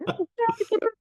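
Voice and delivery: very high, soft delighted giggle; imitated giggle. Woman reenacts her reaction to the catcall, high giggle. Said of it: "And I went".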